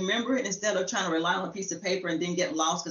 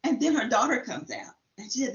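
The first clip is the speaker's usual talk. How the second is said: usual speaking voice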